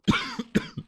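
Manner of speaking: sudden harsh coughing; coughing fit. Real, not acted. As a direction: cough hard after swallowing a fly. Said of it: "I just swallowed a fly".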